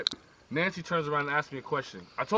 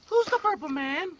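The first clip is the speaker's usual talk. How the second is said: put-on high girlish question; Nancy's innocent high-pitched ask; girl's voice; 13 semitones higher than usual. Nancy asks John the question.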